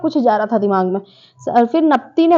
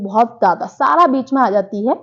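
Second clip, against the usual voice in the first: ordinary talk, nothing marked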